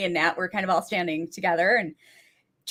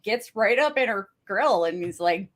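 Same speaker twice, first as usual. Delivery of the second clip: normal talking voice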